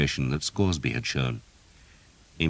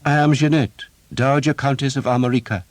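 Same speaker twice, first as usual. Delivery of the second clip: higher, louder, proud noblewoman; proud countess; 10 semitones higher, 6 dB louder than usual. A narrator's reading. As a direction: announce your rank with pride. Jeanette proudly names herself.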